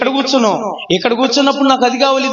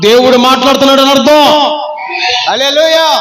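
preaching; 5 semitones higher, 5 dB louder than usual. Acted. Pastor exhorting congregation.

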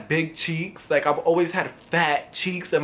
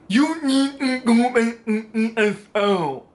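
slow, higher, hammed-up stammer; mocking advice; 6 semitones higher, slower than usual. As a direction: stumble slowly over the words in mockery. Mocks commenter who cannot spell.

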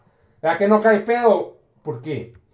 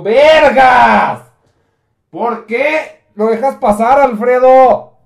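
startled outburst; 4 semitones higher, 8 dB louder, slower than usual. Acted. Bruno startled Robin arrives.

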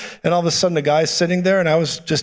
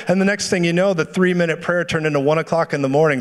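normal talking voice

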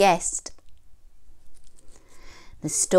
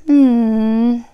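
wolf voice; 6 semitones higher, 11 dB louder than usual. A narrator's reading. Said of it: "thought the wolf".

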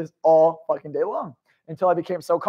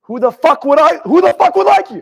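furious high-pitched shout; in-his-face outburst; 10 semitones higher, 11 dB louder than usual. Retold or imitated. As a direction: confront them, loud and incredulous. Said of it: "I got in his face".

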